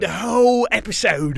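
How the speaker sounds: hammed-up British accent; mock British accent. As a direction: play a posh Englishman. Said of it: "talk like that the rest of the episode".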